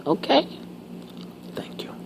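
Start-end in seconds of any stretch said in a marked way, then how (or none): 0.0-0.5 sudden high squeaky okay; high squeak. Real, not acted. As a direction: pop up into a squeak. Squeaky exclamation after telling off.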